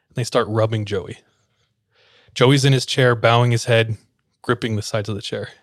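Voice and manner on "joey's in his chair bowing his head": ordinary delivery, nothing marked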